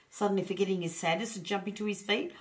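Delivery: usual speaking voice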